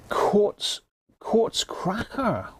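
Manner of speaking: high, slow, drawn-out "Quartz, quartz cracker"; drawn-out beer name. Acted. Savouring the beer's name.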